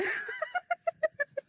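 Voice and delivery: high, squeaky incredulous laugh; disbelieving laugh. Real, not acted. Learning she's allergic to the sun, a high disbelieving laugh. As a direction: laugh in shocked disbelief at the absurdity.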